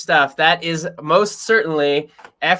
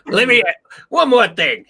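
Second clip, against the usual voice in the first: higher, louder detective impression; quick comic imitation, pitched up; Columbo; 7 semitones higher, 4 dB louder than usual. Teasing co-host for sounding like a detective, mock impression. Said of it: "You kinda sound like Columbo a bit".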